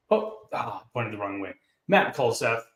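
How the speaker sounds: ordinary talk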